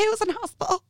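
very high, baffled; confused drugged. Retold or imitated. [name] imitating her drugged confusion, very high. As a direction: ask it totally lost. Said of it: "I was like".

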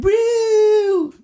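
very high, loud squealing woo; party woo. Retold or imitated. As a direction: whoop like a bachelorette party. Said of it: "all going".

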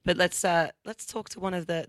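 usual speaking voice

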